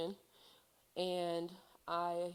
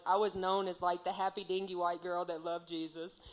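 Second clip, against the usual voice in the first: ordinary talk, nothing marked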